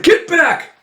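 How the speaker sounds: high, loud warning yell; panicked high bark of a threat; frantic shout. Acted. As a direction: shove her back with your voice. Hunter threatens witch before firing.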